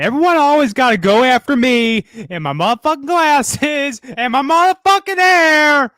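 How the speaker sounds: mock indignant rant. Co-host protesting that everyone goes after him, mock indignant rant. Exaggerated protest at being made fun of.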